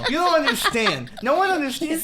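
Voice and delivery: high-pitched, laughing fan gush; gushing excitement. Real, not acted. Podcaster gushing to co-hosts about an actor, giddy high-pitched excitement.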